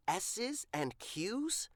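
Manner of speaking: usual speaking voice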